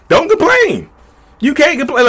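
high, mocking whiny voice; a squeaky mocking "don't complain"; mock whine. Mocking the trapped partner's complaint.

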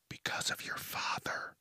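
hushed, voiceless jab; whispered aside. Real, not acted. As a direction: drop to a pointed whisper. Pointed aside about famous father.

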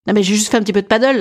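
ordinary delivery

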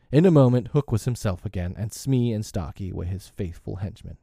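plain reading voice

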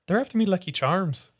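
cereal-advert Irish accent; put-on Irish accent. Shown as a demonstration. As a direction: mimic an Irish cartoon mascot. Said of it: "if I did an Irish accent".

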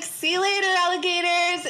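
put-on high-pitched childish sign-off; five-year-old. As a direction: chirp it like a little kid. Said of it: "sound like a five-year-old".

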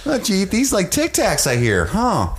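high-pitched folksy Minnesota official; hammed-up Minnesota chatter, high and surprised; Minnesota accent. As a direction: play a chipper Minnesotan way up high. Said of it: "why he's from Minnesota now".

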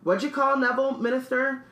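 high-pitched eager question; excitable Stan. Acted. Stan questions the Minister, high eager voice. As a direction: pipe up in a high character voice.

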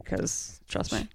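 slow conspiratorial whisper; whispered aside. Real, not acted. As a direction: whisper it like a secret. Joking aside about Top Gun scene.

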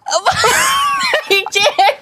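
high squealed protest through laughter; real laughing wail, very slow and high; helpless laughing. Real, not acted. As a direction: drag it out while cracking up. Breaks down laughing under dare.

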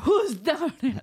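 slow, high, bad Ukrainian accent. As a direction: call out in a poor Ukrainian accent. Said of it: "That's my Ukrainian accent".

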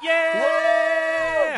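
loud high cheer; excited shout. Real, not acted. Friend hearing she asked for the next show, cheering. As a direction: cheer the good news.

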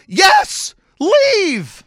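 high, loud bellowed order; exasperated shout. Retold or imitated. Fan ordering woman out during the game, exasperated shout. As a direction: shout her out of the room. Shouted answer telling her to leave.

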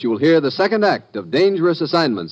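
ordinary talk, nothing marked

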